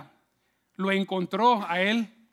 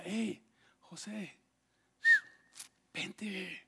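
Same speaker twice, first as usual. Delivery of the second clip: slow, hushed beckoning whisper; seductive whisper; 8 dB quieter, much slower than usual. Acted. Potifar's wife tempts José, slow seductive whisper. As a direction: call him over in secret, whispering. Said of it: "le decía".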